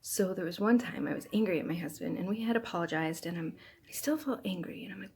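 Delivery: ordinary delivery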